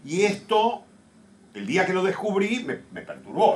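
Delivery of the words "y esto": ordinary talk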